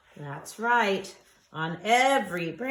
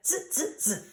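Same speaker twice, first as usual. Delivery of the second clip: monkey chatter; 7 semitones higher than usual. A narrator's reading. Monkeys mocking the peddler.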